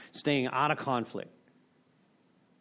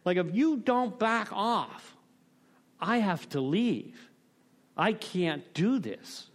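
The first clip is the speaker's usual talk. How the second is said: retold losing it; 5 semitones higher, slower than usual. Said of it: "I just lost it".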